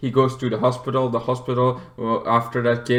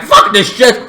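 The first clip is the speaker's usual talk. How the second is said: a higher, loud, frantic curse; panicked yell; 8 semitones higher, 8 dB louder than usual. Retold or imitated. Running to his car, yelling in panic. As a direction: shout it while running.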